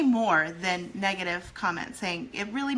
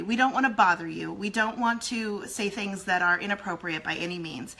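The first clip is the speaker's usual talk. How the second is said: ordinary talk, nothing marked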